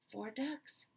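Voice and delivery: ordinary talk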